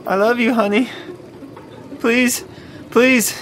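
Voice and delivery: begging, pitch rising on each plea; pleading. Acted. Trapped man begging Jasmine to free him, pleading. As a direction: beg to be saved.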